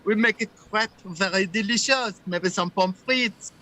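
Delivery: hammed-up French Canadian English; French Canadian accent. Selling crepes for Holly's request, French Canadian accent. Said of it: "Can you do a French Canadian accent".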